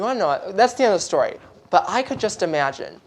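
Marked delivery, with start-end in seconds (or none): none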